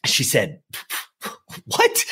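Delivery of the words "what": very high-pitched, incredulous squeak; acted disbelief, voice shooting way up; baffled surprise. Mom reacting to son's odd request, baffled surprise.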